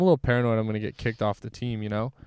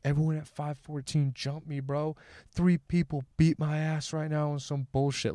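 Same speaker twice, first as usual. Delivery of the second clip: mild mock yell, pitched up; retold drunk screaming; 4 semitones higher than usual. Drunk friend claims he got jumped, higher, half-voiced mock screaming. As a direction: complain like an outraged drunk, without real volume. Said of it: "he was screaming".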